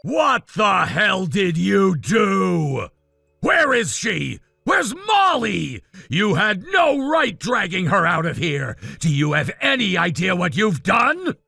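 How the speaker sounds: a jilted groom shouting at the sister who took the bride away; angry confrontation. Acted.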